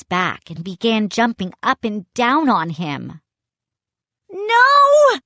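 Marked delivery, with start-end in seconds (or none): 4.3-5.2 high, loud desperate cry; boy crying out as his friend is tackled; anguished cry. A narrator's reading. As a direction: cry out in horror. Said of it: "A cry escaped my throat".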